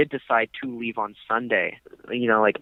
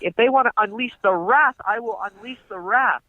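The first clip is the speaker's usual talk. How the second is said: high, hammed-up threats; mock threatening rant; 9 semitones higher than usual. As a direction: threaten like a blustering landlord. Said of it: "He says".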